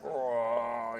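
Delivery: low, drawn-out zombie groan; zombie moan. Retold or imitated. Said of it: "like, zombie moans".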